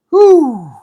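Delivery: high, loud "whew" of amazement; impressed whistle-like whew. Real, not acted. Admiring the C-3PO flashlight keychain, a loud high whew.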